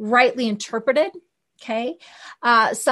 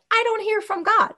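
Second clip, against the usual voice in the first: high, whiny imitation of a doubter; mock complaining thinker; 10 semitones higher than usual. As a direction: pitch it up like a discouraged doubter. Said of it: "A lot of the thinkers say".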